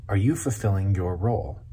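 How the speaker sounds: ordinary delivery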